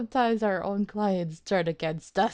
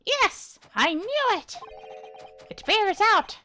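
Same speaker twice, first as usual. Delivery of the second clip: much higher, louder, triumphant eccentric; high-pitched scientist voice; 11 semitones higher, 4 dB louder than usual. Acted. Scientist reassuring the defense lawyer, high and triumphant. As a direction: squeak out the triumph of a mad scientist. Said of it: "it was so high-pitched".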